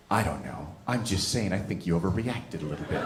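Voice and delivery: low, soft, offhand reply; downplay it quietly, as if unconcerned; unbothered understatement. Retold or imitated. Partner downplays his grease burn, low and offhand.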